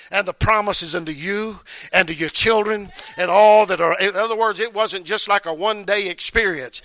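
loud preached scripture quote; full-force preaching. Acted. Preacher proclaiming the promise to the church, loud and fervent.

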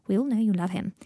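plain reading voice

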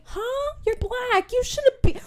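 high, rising disbelief; mock-shocked and loud; loud, high incredulous. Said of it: "And I'm like".

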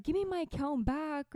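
high mock demand; mock angry demand. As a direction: pretend to bark at the hacker. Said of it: "but I was so mad".

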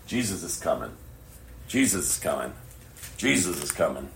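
normal talking voice

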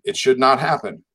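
ordinary talk

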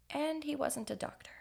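soft, high half-whisper; hushed reveal. A narrator's reading. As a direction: drop to a hush for the reveal. Hushed punchline in narration.

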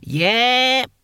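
put-on high, piping, pleased old voice; high-pitched happy agreement; happy old deaf man. A narrator's reading. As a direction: chirp it like a contented old codger. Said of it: "said Hamish happily".

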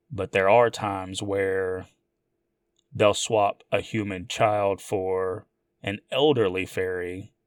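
usual speaking voice

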